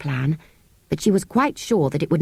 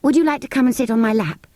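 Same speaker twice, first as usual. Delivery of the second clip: put-on kind offer in a higher, girlish voice; kindly; 6 semitones higher, faster than usual. A narrator's reading. Anthea offering the cold sand fairy her lap, kindly. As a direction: coax the creature kindly. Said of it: "said Anthea kindly".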